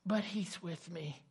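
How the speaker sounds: soft, dropping toward a whisper; retold tender assurance, nearly whispered; hushed reassurance. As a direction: settle quietly into trust after the outburst. Voices widow's hushed reassurance.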